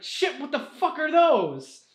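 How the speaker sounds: high, slowed, startled curse; shocked swearing. Acted. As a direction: gasp it out, caught off guard. Gresh sees the enemy pour out.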